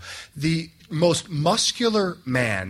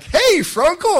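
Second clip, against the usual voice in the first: put-on high, eager child's call, louder; little kid greeting; 11 semitones higher, 6 dB louder, much slower than usual. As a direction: call out brightly like a small child at communion. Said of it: "they're like".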